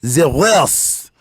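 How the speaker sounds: hammed-up angry Frenchman accent. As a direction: scold with a thick French accent. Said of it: "He had a French accent".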